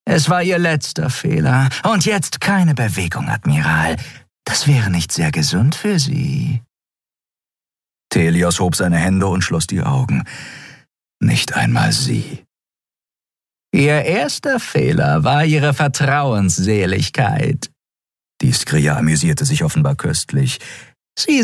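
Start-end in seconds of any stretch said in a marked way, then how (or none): 0.1-6.6 high purring villainess. Acted. Shiar purrs behind the admiral's back, menacing. 13.7-17.7 high purring villainess. Acted. Shiar purrs behind the admiral's back, menacing.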